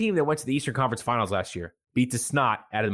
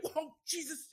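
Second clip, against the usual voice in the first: startled; 14 dB quieter, slower than usual. Retold or imitated. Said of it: "You're like".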